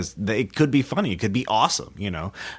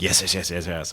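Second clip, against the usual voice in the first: verbal tic voice; 3 semitones lower, 3 dB quieter than usual. Shown as a demonstration. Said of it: "verbal tics".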